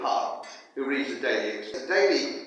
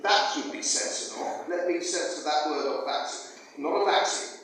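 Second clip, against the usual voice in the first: ordinary talk, nothing marked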